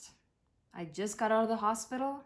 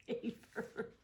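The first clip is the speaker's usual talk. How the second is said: laughing; 8 dB quieter than usual. Real, not acted. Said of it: "Like he's watering my plants".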